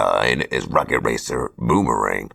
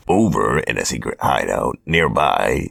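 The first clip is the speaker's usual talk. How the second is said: ordinary delivery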